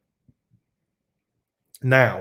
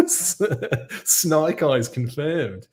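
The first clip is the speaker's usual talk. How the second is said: put-on Australian accent; 5 semitones higher, much slower than usual. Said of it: "doing it in an Australian accent".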